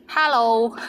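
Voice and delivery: hello in a Cantonese accent, with an open "ha" vowel. Shown as a demonstration. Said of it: "with the Cantonese accent".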